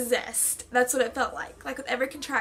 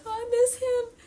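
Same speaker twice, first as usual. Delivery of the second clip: high, tearful longing; a mother missing her son, tearful; crying for her son; 11 semitones higher than usual. Retold or imitated. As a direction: voice rising into tears. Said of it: "And then I start crying".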